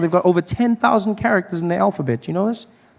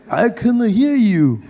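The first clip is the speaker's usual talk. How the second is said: mock Japanese man; 5 semitones higher, slower than usual. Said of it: "he's like".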